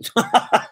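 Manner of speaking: quick unvoiced giggle; a breath of laughter at her; breathy laugh. Real, not acted. Vlogger giggling at the woman's BMW surprise, unvoiced laugh.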